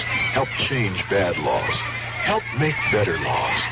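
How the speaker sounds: normal talking voice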